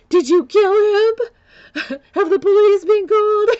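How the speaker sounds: high, loud mock panic breaking into laughter; panicked alarm. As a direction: blurt out the alarm, half laughing at it. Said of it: "the first thing I thought was".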